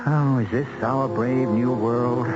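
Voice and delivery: ordinary talk, nothing marked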